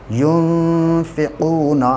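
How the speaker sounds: slow recitation of युनफ़िक़ूना, the वाव drawn long; Quranic recitation. Shown as a demonstration. Teacher demonstrating tajweed to students, slow recitation. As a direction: stretch युनफ़िक़ूना, the ू held. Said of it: "इसे थोड़ा खींच के पढ़ेंगे".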